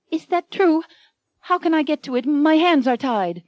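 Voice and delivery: high, doubting heroine voice; wary heroine. Acted. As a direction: ask warily, still tied up. Anne suspicious.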